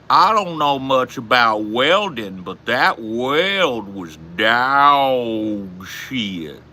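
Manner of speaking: very slow, loud Southern drawl; Southern accent. Shown as a demonstration. Showing chat how the South talks, heavy slow Southern accent. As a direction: stretch it out in a hammed-up Southern accent. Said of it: "the South".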